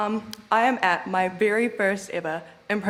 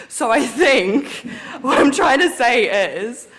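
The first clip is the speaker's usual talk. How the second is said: laughing; 4 semitones higher, 8 dB louder than usual. Real, not acted. Laughing at her own fumble.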